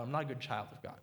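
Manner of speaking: quiet, reflective repeat. Real, not acted. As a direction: drop it to a hushed, heavy echo. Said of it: "repeated slowly and quietly".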